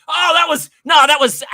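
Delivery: loud, high, flustered excuse; flustered cover-up. Retold or imitated. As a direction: cover it up in a panic. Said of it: "I tried to play it off like".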